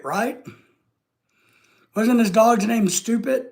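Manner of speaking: ordinary delivery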